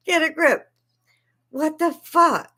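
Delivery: ranter snaps at the opposing side, exasperated; exasperated retort. Real, not acted. Ranting at critics.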